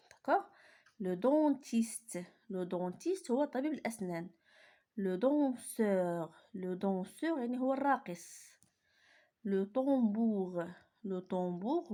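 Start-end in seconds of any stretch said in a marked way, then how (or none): 1.0-2.2 "Le dentiste" drawn out slowly; slow teacher reading; slow model reading. Shown as a demonstration. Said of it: "je lis les groupes des mots". 5.0-6.3 "Le danseur" drawn out slowly; slow teacher reading; slow model reading. Shown as a demonstration. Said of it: "je lis les groupes des mots". 9.4-10.6 "Le tambour" drawn out slowly; slow teacher reading; slow model reading. Shown as a demonstration. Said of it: "je lis les groupes des mots".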